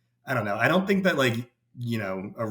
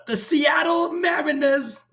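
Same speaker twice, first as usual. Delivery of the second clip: 1 mock fan scream; 4 dB louder, much slower than usual. Imitating the viral fan.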